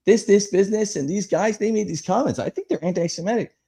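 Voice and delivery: high, lightly voiced accuser; mock Facebook post. Retelling neighbors' Facebook posts, mock outraged high voice. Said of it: "they start posting on Facebook".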